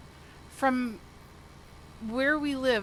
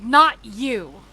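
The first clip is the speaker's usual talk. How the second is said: much louder, cutting command; sharp loud retort; 12 dB louder than usual. Retold or imitated. Boyfriend stopping her from walking away, loud sharp order. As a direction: snap it out to stop her leaving. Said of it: "And he's all pissed off".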